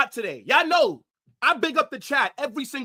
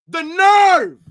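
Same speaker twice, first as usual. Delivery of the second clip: The nerve yelled much louder and higher; outraged shout; 5 semitones higher, 10 dB louder than usual. Real, not acted. Fan outraged at a player's Instagram post, yelling. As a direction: explode on The nerve in disbelief. Repeats phrase, then explodes.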